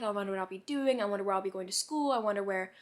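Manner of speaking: ordinary talk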